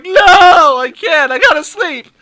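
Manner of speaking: high, loud protest; loud mock pleading. Sleepless boyfriend refusing girlfriend, loud acted pleading. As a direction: wail back in hammed-up desperation.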